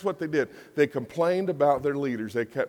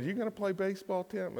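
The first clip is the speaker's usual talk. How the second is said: higher, quick and soft mom voice; mom's voice; 6 semitones higher, 6 dB quieter than usual. Retold or imitated. Mom asking her son about baseball, gentle and higher. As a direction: lightly voice a mother asking gently.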